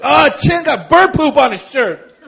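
loud high teasing taunt; mocking kid. Friend taunting Chin about bird poop, loud and high. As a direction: jeer like a schoolyard kid. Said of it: "He's like".

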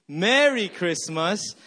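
"Merry" with MER punched, slow; stress on first syllable. Shown as a demonstration. Pastor leading the game together, stress on MER of "Merry". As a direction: hit MER in "Merry" hard. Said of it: "you emphasize every syllable".

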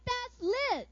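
excited, high-pitched exclamation; amazed onlooker. Acted. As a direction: blurt it out like a wowed spectator. Awed onlooker at the fireworks.

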